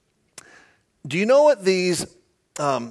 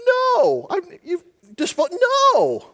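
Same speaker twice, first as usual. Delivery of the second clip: Balaam's flustered no; 11 semitones higher than usual. Acted. Balaam answering his donkey, high flustered stammer. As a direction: squeak out the no, then stumble. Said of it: "And he answers".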